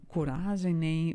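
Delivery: ordinary delivery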